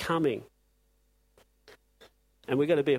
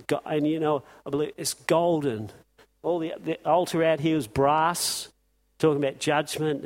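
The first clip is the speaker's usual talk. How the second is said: normal talking voice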